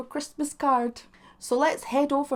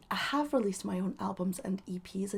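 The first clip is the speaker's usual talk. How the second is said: softer, whispered brag; whispered aside; 3 semitones lower, 8 dB quieter than usual. Acted. Telling viewers about her music, whispered. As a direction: lean in and whisper it.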